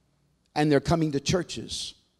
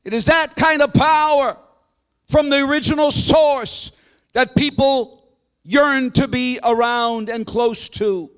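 full-force preaching; 9 semitones higher, 8 dB louder than usual. Real, not acted. Preacher telling the church God is able, impassioned full-force preaching. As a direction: ring it out to the whole congregation, stretching key words.

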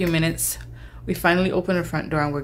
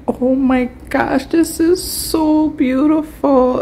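higher, slow, teary gasp of wonder; overwhelmed awe; 7 semitones higher, 4 dB louder, slower than usual. Retold or imitated. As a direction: let the beauty overwhelm you. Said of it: "covered my face and cried".

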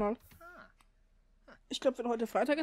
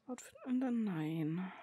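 breathy unvoiced whisper; 2 semitones lower, 3 dB quieter than usual. Real, not acted. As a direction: whisper it to yourself. Whispered asides while playing.

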